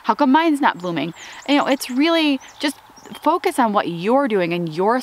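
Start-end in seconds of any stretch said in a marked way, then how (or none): none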